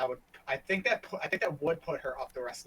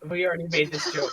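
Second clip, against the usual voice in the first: laughing while saying it; 3 semitones higher, 6 dB louder than usual. Real, not acted. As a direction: chuckle through the words. Laughing at a repeated joke.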